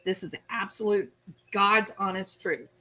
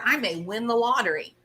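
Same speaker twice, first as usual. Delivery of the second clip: ordinary delivery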